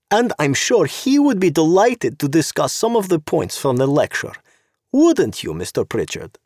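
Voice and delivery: high, purring voice of a sharp-clawed society woman, mocking; sweetly catty, like an angry cat smiling; sly socialite. Zorena voiced by narrator.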